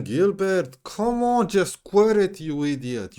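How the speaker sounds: high, loud scolding shout; frustrated yell. Real, not acted. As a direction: yell at the screen at a player. Shouting at his player.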